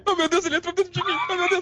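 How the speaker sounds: high, fast, shrieking panic; mock panicked giant. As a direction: squeal like a startled giant. Mocking the giant's complaint.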